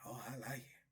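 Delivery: lightly voiced leering inmate; soft leering voice. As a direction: purr it like a leering admirer. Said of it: "one of them old Bama's be like".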